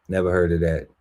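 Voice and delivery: usual speaking voice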